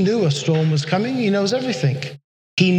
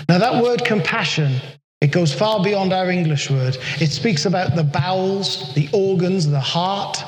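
ordinary talk

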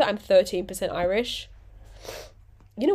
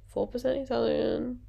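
softer, put-on Italian accent; mock Italian accent; 2 semitones higher, 5 dB quieter than usual. Vlogger joking about Instagram bio, mock Italian accent. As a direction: play at an Italian accent, softly. Puts on Italian for her ancestry.

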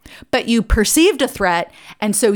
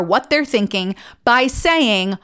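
normal talking voice

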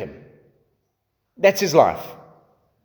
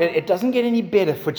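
ordinary talk, nothing marked

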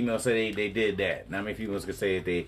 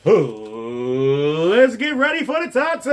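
high shouted show intro; hype announcer shout; 7 semitones higher, 5 dB louder, much slower than usual. Acted. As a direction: announce it at full voice.

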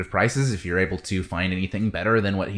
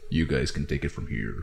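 lightly voiced, lower, softer Saurian; John the Saurian leaving the crew, a touch lower; Saurian handoff; 7 semitones lower, 6 dB quieter than usual. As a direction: hand them off with a shrug. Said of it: "looks at you guys like".

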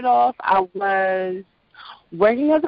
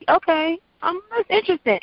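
intrigued; 10 semitones higher than usual. Retold or imitated. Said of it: "I'm like".

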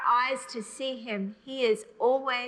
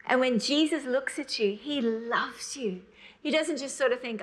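ordinary talk

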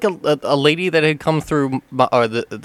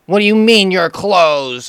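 indignant customer; 6 semitones higher, 7 dB louder, slower than usual. Retold or imitated. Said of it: "And she's like".

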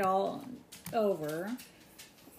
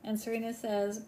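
normal talking voice

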